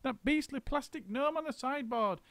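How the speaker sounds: very high, fast rattling list; high rushing monkey list; frantic list. A narrator's reading. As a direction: reel it all off breathlessly. Mugglewump listing orders.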